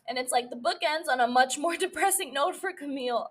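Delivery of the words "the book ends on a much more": ordinary talk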